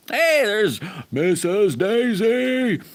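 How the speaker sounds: slow, high, loud sung-out hello; happy greeting. Real, not acted. Greeting a regular viewer in the live chat with a long, high, loud hello. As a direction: call her name out like a welcome.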